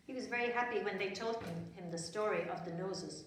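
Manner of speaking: ordinary delivery, nothing marked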